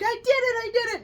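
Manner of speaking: high, loud gleeful cry; mock happy triumph. Imitating the happy husband to his wife, mock triumph. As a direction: crow it like an overjoyed winner. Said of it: "He's happy".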